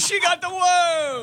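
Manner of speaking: high, stretched-out celebratory call; hammed-up winner's cheer, slow and high; mock triumphant cheer. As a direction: crow it like a jackpot win. Mock cheer about worm.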